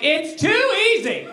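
high, drawn-out mock demand; jaded thrill-seeker. Mocking diner who wants challenge mode, high theatrical complaint. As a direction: declaim it high and slow, theatrically bored.

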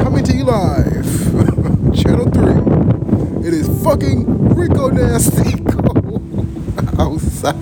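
mock news reporter. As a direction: play a live TV reporter. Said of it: "if I was a news reporter".

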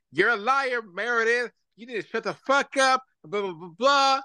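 high mock shout at Meredith; shrill-free high yell, imitating Lisa. Lisa yelling across the boat at Meredith, high mock shout.

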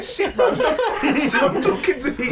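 high, loud, laughing mock insult; laughing high mock retort; laughing comeback. Shown as a demonstration. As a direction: giggle through the put-down. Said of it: "just say".

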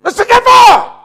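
loud high call across the ward. Retold or imitated. Preacher calling a dying man's name.

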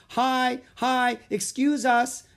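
high, loud, drawn-out greeting; cheerful yell. A narrator's reading. Girl waves to villagers from elephant, high yell. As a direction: yell hello while passing by. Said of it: "Annie yelled, waving".

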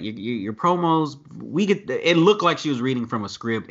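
usual speaking voice

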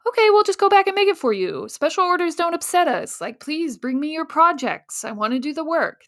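high, fast, chirpy service voice; overeager clerk. Retold or imitated. Imagined printer eagerly agreeing to every special order. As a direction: gush like a too-eager clerk.

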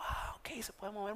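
breathy whispered aside. Retold or imitated. Therapist murmurs that the foot can move, in a whisper. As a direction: whisper it to yourself.

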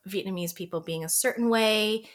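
ordinary delivery

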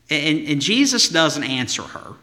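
ordinary talk

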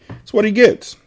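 high mocking jab. Real, not acted. Mocking dead Mamu.

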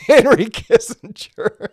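breaks into a laugh before "Kissinger"; can't hold back a laugh on "Henry Kissinger". Real, not acted. Teacher reading a viewer's joke aloud, laughing. Reading a funny chat comment.

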